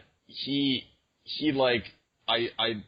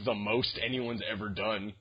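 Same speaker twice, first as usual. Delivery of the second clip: usual speaking voice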